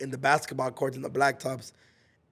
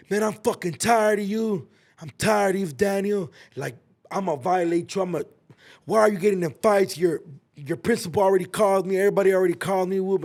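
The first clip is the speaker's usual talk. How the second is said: higher, louder, fast scolding; angry PO officer; 6 semitones higher, 4 dB louder than usual. Retold or imitated. The probation officer scolding him over the phone, angry. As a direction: chew him out like a fed-up officer.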